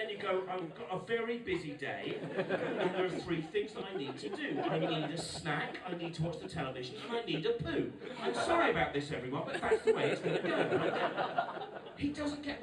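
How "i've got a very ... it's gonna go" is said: put-on unembarrassed child. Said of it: "when you're a child, you can just sit there".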